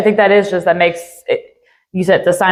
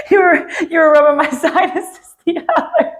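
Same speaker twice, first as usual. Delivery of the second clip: laughing through words; 8 semitones higher than usual. Real, not acted. Laughing while recalling the sinus rub.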